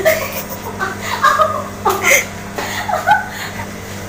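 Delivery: giggling laugh. Real, not acted. Laughing at the spoon.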